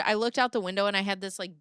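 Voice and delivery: ordinary delivery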